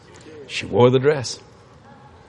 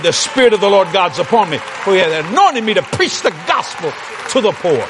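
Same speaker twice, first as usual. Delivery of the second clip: loud, higher scripture proclamation; preaching; 4 semitones higher, 3 dB louder than usual. Acted. Preacher to congregation, proclaiming scripture loudly. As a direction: preach it with full force. Quoting scripture at full force.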